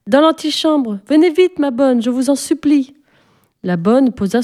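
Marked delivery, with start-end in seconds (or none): 0.1-2.9 put-on high urgent child begging; much higher, pressing child plea; pleading little girl. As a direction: beg her to hurry, impatient. Sophie pleading eagerly.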